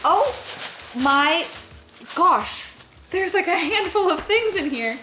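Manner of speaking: thrilled gasping delight over a gift; excited squeal. Real, not acted. Opening the surprise package.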